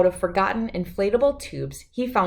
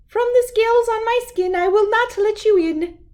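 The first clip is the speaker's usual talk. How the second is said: very high, louder squeaky fish voice; tiny fish voice; 5 dB louder than usual. A narrator's reading. Second little bass refusing the gar, very high squeak. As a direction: squeak it up fast and defiant.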